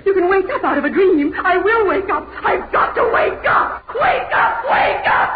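fast, building to high, loud shrieks; frantic shouting. Acted. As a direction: scream yourself awake. Forcing herself awake from a nightmare.

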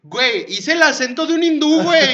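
high, shouted comeback; loud retort. Retold or imitated. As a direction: yell it back in mock outrage.

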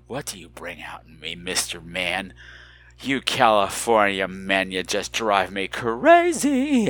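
low, slow flirty purr; mock flirtation. Host teasing a California caller, mock flirtation.

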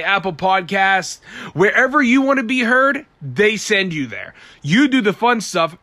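upbeat podcast ad read. Acted. Host reads the sponsor ad, upbeat ad voice. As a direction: sell it like a sponsor spot.

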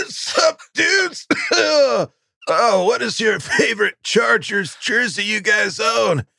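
high, slow stoner drawl; lazy surfer-dude stoner. Acted. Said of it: "Nothing like the stoner voice".

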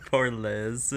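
ordinary talk

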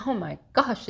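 ordinary delivery

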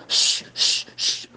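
repeated long shushes; drawn-out "shh", mimicking the shusher; imitated shushing. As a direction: try to silence the whole congregation. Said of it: "tried silencing everybody up".

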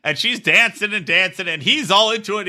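ordinary talk, nothing marked

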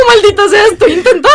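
high, loud, half-laughing whine; mock exasperated wail. Imitating someone struggling with the photo, high exasperated wail. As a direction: wail it in pretend frustration.

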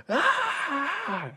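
high shrieking woman's scream; short piercing mock scream; female scream. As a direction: imitate a ghostly woman screaming. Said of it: "this loud female scream".

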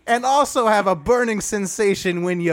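Dwight Howard voice. Acted. Podcaster ending his Dwight Howard joke slow and laughing. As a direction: hold the slow, high voice, then crack up. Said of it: "It's a Dwight Howard thing".

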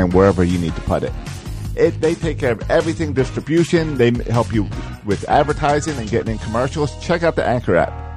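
upbeat podcast ad read. Acted. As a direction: read it like a radio ad. Podcast ad read over music.

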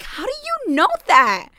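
put-on a mother, stunned, asking her toddler how he knows this; high-pitched shocked question. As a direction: voice jumps high in disbelief. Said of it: "I'm like".

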